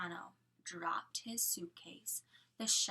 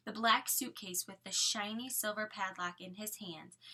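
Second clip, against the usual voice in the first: usual speaking voice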